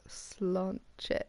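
whispered Slonche; whispering. Shown as a demonstration. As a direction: breathe the word Slonche with no voice. Echoing the voice line.

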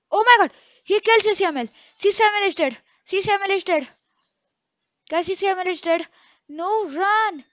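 fast, high, loud panic; shocked a teammate just died; panicked. Real, not acted. Teammate killed by ghost.

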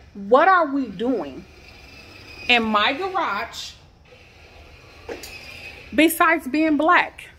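slow, pained and pleading; upset pleading. Real, not acted. Mother tells Deanna about the police call, slowly pained. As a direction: draw each word out in hurt disbelief.

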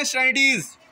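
"Stand at ease" run together as one quick word; rapid drill-style "Stand at ease", words fused; said quickly. Shown as a demonstration. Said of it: "जल्दी से क्या पढ़ते हैं".

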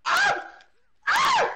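loud high-pitched yelp; shrieking in game panic; short screams. Real, not acted. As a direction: scream it in two sharp bursts. Hit in game.

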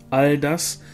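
ordinary delivery

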